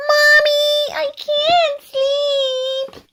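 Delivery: high, drawn-out whine; young bunny calling out. A narrator's reading. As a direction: wail slowly for mommy from bed. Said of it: "second youngest bunny call out".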